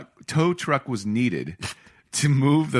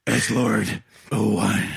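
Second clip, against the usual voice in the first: mock Batman; 2 semitones lower, slower than usual. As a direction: spell the plate out gravely. Batman naming his plate.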